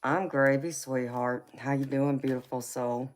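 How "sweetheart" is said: ordinary delivery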